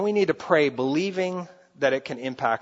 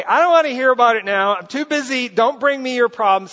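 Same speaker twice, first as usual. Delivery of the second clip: dismissive brush-off; 7 semitones higher, 7 dB louder than usual. Retold or imitated. Dismissive God voiced by preacher.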